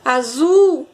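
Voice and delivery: "azul" called out with a long ZUL; as if calling someone named "azul", zul stretched; calling the word. Shown as a demonstration. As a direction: lean hard on the last syllable of "azul". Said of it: "como se eu estivesse chamando a palavra".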